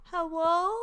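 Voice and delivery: put-on high, loud child's hello; little kid voice. Gamer imitating childhood self on tape, little kid voice. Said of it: "I just sound like this little kid".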